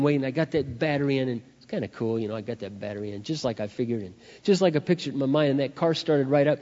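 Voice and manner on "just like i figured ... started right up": usual speaking voice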